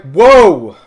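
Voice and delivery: loud, sudden whoa; surprised whoa. Real, not acted. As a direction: blurt it out in surprise. Reacting to a chat message.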